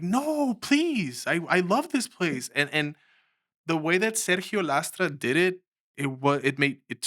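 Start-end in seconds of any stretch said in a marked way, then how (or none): 0.0-2.5 high, breaking voice begging to stay; crying plea. Retold or imitated. Fired teen begging the boss, voice breaking high. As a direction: plead through tears, pitch cracking up high. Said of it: "I started crying".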